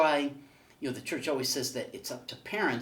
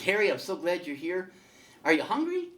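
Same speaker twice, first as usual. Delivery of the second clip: God asking; 6 semitones higher, 5 dB louder than usual. Acted. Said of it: "God comes up to him and says".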